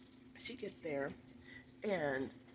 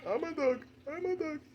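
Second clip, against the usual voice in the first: playful dog voice; 9 semitones higher, 4 dB louder than usual. Pretend.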